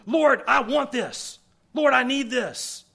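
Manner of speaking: high, slow, insistent plea; imitated urgent pleading; demanding prayer. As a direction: beg the Lord like someone set on getting it. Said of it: "how often do I tell the Lord".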